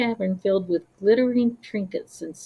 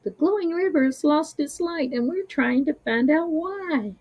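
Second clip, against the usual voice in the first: polite; 7 semitones higher than usual. Acted. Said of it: "they explained politely".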